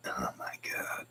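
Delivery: breathy whispered craving for biscuits and gravy; hushed private whisper. Real, not acted. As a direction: whisper it to yourself, longingly. Whispered aside about biscuits.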